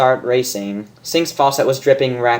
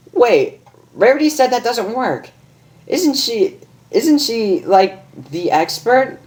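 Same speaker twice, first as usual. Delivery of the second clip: much higher doubtful question; Rainbow Dash voice; 9 semitones higher than usual. Acted. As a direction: push back, unsure.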